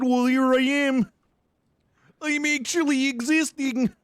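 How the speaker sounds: put-on high, bright Minnesotan-Wisconsin accent; pretending to be Dakota in a Wisconsin accent. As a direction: play the cheerful Wisconsin local in a high voice. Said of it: "Minnesotan, Wisconsin accent".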